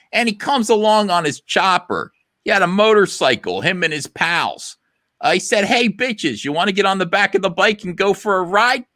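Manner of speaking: hammed-up grandma telling her romance; retelling how she met Pop Pop in a high grandma voice; grandma impression. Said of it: "she goes".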